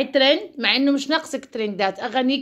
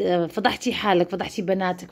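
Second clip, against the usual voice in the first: normal talking voice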